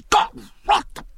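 sharp high oath, bitten off mid-word; swear, then stop yourself short; cut-off curse. Acted. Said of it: "he clapped his mouth shut".

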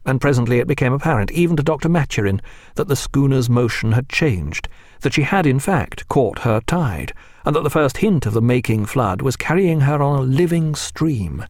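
plain reading voice

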